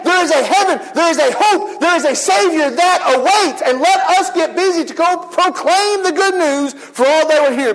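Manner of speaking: rally the church to go out; preaching at full force. Real, not acted. Preacher exhorting congregation.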